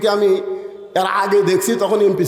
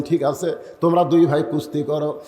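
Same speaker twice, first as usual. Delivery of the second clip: ordinary delivery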